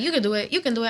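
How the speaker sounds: quiet coaxing encouragement; encouraging. Retold or imitated. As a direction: gently urge her on. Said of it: "She always used to be like".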